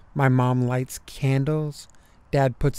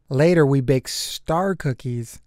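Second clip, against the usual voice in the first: ordinary delivery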